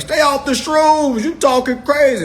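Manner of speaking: high, loud mocking voice of the doubters; high, loud mock scold from the folks who laughed. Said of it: "They were like".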